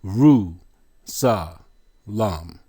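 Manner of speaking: "Ru Sa Lam" split by pauses; syllable by syllable. Shown as a demonstration. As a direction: sound out "Ru Sa Lam" one beat each. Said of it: "Four syllables".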